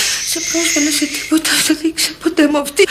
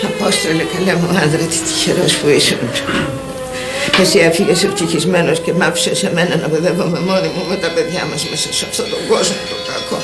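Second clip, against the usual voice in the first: low, grieving lament to a dead husband; 9 semitones lower than usual. Acted. Widow grieves aloud to her late husband, low lament. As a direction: mourn him, voice sunk low.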